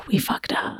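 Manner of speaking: soft unvoiced whisper. Real, not acted. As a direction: breathe it out under your breath. Repeated as a whispered aside.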